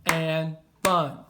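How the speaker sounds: said slowly, counting out each syllable; slow, even beats while writing. Shown as a demonstration. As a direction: tap out each beat of "Cats are cute and fun". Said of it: "So that's five syllables".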